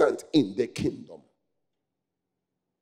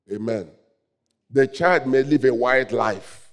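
normal talking voice

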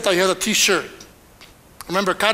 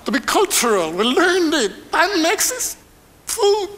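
a light son's voice climbing higher to the end; imitating his son; 7 semitones higher than usual. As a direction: play the son explaining himself, voice rising more and more. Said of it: "He said".

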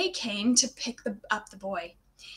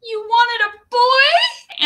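slow, much higher, louder wail; 11 semitones higher, 9 dB louder, much slower than usual. A narrator's reading. Heartbroken girl learning they wanted a boy, wailing. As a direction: wail it out in dismay. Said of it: "Anne wailed".

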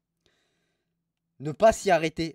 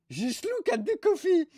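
high, slow mock English outrage; imitating an angry English reviewer, much higher; angry English tourist; 12 semitones higher, slower than usual. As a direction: squeal it like an outraged Brit tourist. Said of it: "Regarde l'anglais comme il était énervé".

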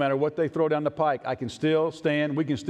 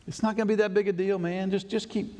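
higher, quick wheedling push; coaxing friend; 7 semitones higher than usual. Acted. Friend urging Daniel in a higher coaxing voice. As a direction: brush off the worry, wheedling.

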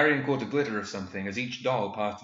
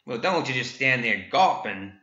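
louder, sharp impatient snap; snapped; 3 semitones higher, 5 dB louder than usual. A narrator's reading. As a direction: bark at him to move. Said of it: "snapped Grizzly Dick".

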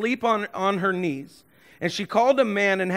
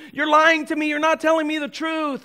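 hurt accusing Delilah; 8 semitones higher than usual. Acted.

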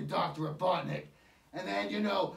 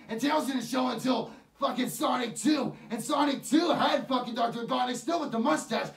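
real heated rant, higher and louder, near shouting; angry rant; 4 semitones higher, 4 dB louder than usual. Real, not acted. Reviewer ranting furiously at the Sonic movie makers, real heated shouting. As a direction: vent the fury at the filmmakers. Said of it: "what really pisses me off".

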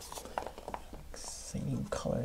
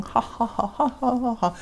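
laughing; 6 semitones higher than usual. Real, not acted. Laughing at herself after outtake.

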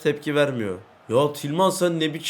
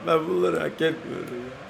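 mock crying; 6 dB quieter than usual. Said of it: "Nasıl rol yaptım ağlama rolü?".

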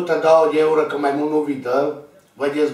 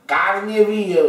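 agitated exclaim; 5 semitones higher, 4 dB louder than usual. Real, not acted. Describing the wound again.

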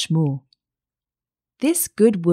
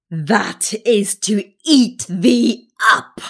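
slow, emphatic wolf threat, raised pitch; menacing wolf; 4 semitones higher, 4 dB louder, much slower than usual. A narrator's reading. Wolf about to devour the child, slow menacing voice. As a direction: land each word slowly, a predator about to pounce.

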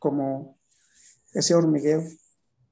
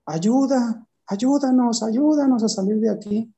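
high pleading cry to be let out; higher mock cry of trapped souls; begging souls; 5 semitones higher than usual. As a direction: plead to be pulled out of there. Hands reaching out, shouting.

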